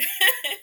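a sudden high loud laugh; high burst of laughter. Real, not acted. Guest laughing at the host's echo, high laugh. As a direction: let a real laugh burst out.

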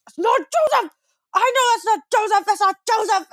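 put-on high, insistent child's shouting; child yelling. Little brother losing it in the balcony, high child's yelling. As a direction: keep protesting like a furious toddler. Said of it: "He goes".

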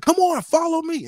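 mock excited guide waving everyone on; eager guide. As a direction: squeal it out like a hyped-up leader. Guide urging group uphill.

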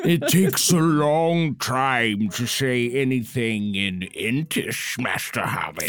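long-drawn, plodding tree-creature voice; slow-talking Ent. Acted. An Ent explaining Entish to a hobbit, slow and ponderous. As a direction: stretch every word out like an ancient Ent. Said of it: "She's playing an ant".

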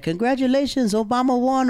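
high bright mock neighbor voice; cheery neighbors. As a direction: Dutch neighbors congratulating his dad, high cheery.

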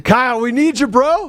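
calling out. Acted. Host calls out to reporter Kyle to join the stream. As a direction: call out to him across the room.

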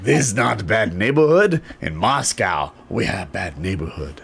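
slow, mock Russian accent; badly faked Russian accent. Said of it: "Your Russian is terrible".

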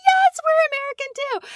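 high squealed delighted reply; excited. Retold or imitated. Two sister missionaries answering a fellow American, excited squeal.

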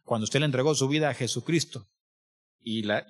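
usual speaking voice

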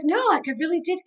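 half crying while insisting she really did; tearful insistence. Retold or imitated. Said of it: "And I'm like".

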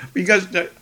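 ordinary delivery

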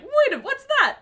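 high squawky duck voice; Donald Duck voice. Retold or imitated. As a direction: quack it like Donald. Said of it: "Donald's like".

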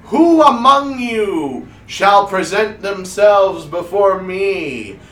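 slow, loud, drawn-out stage proclamation; grand wizard showman. Acted. Wizard introduces his mystery box to the crowd, grandly proclaiming. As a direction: announce it slowly with big rolling swells.

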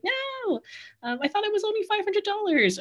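high, quick indignant no; indignant protest. Retold or imitated. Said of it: "And I'm like".

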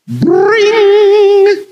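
high, loud ringing bring; phone ring. Shown as a demonstration. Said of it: "What does a phone sound like?".

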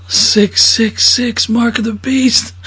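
very slow, drawn-out mock ominous; slow ominous quote. Comedian quoting a film line, slow mock ominous. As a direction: drag each word like a doom prophet.

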